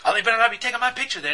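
mock huffy outburst; retold anger. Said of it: "she was angry".

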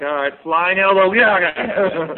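loud, high, drawn-out mock yell; mock battle yell. Imitating Henderson throwing a flying elbow, loud mock yell. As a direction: play-act a triumphant flying elbow.

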